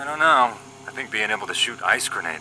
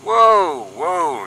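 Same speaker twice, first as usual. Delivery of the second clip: whoa; 10 semitones higher, 8 dB louder than usual. Acted. Calming partner's dark imagination.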